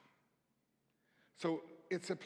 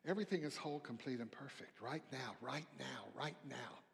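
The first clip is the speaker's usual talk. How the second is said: soft affirmation; 3 semitones lower, 7 dB quieter than usual. Acted. Affirmation against fear, softly.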